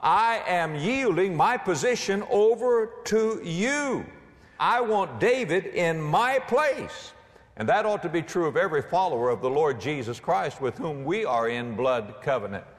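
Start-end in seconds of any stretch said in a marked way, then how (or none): 0.0-7.1 high, slow, measured declaration; lightly voiced, solemn pledge with drawn-out words; Jonathan yielding. Jonathan yielding his position to David, earnest pledge.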